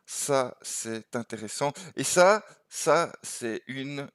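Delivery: ordinary talk, nothing marked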